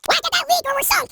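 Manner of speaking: high, urgent crew call; panicked battle-stations cry; urgent alarm. Acted. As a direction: call out the emergency, pitched high. Crew shouts damage reports in battle.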